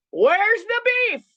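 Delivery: high, loud, drawn-out catchphrase cry; quoting a catchphrase. Retold or imitated. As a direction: belt it out like an old commercial slogan. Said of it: "and he says".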